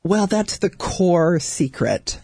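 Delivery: drawn-out, mysterious build-up; slow teasing reveal. Real, not acted. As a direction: stretch it out like a big secret.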